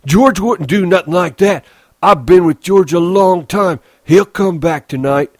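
put-on high, loud childlike insistence; upset Lennie. Lennie insists to Crooks that George will return, upset and loud.

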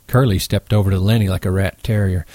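usual speaking voice

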